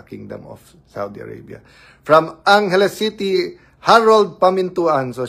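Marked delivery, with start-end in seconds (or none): 2.0-5.1 high, loud, slow "From Angeles City" announced to the viewers; loud name call. Real, not acted. (malakas at mabagal na pagtawag ng "Angeles City")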